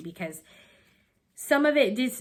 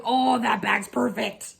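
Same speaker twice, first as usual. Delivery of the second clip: louder, mildly excited self-quote; retold delight; 5 dB louder than usual. Retelling her reaction to the cute bag, lightly excited. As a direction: re-enact a pleased find, a bit louder. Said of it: "I was like".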